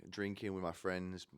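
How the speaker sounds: usual speaking voice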